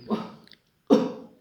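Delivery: sharp loud cough sound; loud mock cough. Shown as a demonstration. Teacher demonstrating coughing, loud mock cough. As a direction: bark a cough. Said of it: "اس طرح سے نکلتی ہے نا۔".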